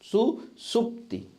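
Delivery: सुसुप्ति drawn out, every syllable separate; slow dictation. Shown as a demonstration. Teacher dictating सुसुप्ति to students, slow and deliberate. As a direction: say सुसुप्ति slowly so they can write it. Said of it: "अगला है सुसुप्ति".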